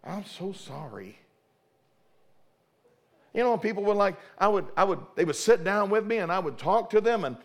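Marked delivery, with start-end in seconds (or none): 0.0-1.2 soft, slow, fading apology; hushed, sheepish I'm so sorry; meek apology. Retold or imitated. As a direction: grovel softly, slowing down. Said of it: "so I was like".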